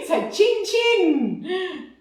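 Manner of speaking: chin chin drawn out very slow, high and loud; cheery toast. Acted. Host raising a glass to viewers, slow loud cheery toast. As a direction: stretch out a bright high chin chin.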